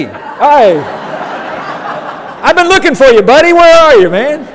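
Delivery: high, loud, laughing call; calling out buddy. Retold or imitated. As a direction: shout for a friend while laughing. Voicing friend calling out, laughing.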